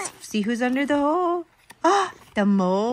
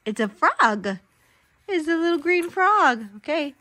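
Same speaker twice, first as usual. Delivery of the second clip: usual speaking voice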